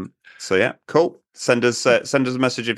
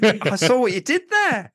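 teasing; 12 semitones higher than usual. Real, not acted. Teasing reply to pun.

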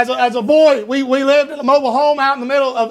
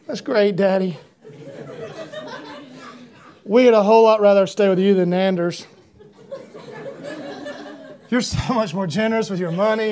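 sarcastic; 3 semitones lower, 4 dB quieter than usual. Retold or imitated. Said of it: "we thought".

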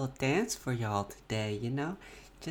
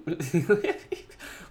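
giggle. Real, not acted. Laughing at being tickled.

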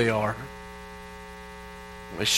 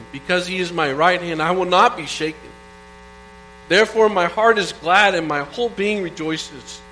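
scripture reading. Acted. Reads Psalm sixteen aloud.

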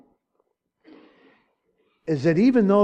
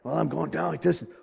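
usual speaking voice

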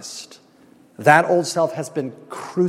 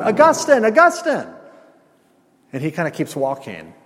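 calling out; 5 semitones higher than usual. Retold or imitated. Old mistress calling out across street.

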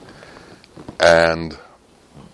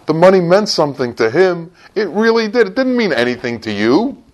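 rising, higher preaching climax; impassioned preaching; 13 semitones higher, 3 dB louder than usual. Acted. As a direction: drive the point home with growing fervor.

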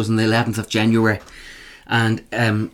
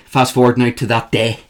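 usual speaking voice

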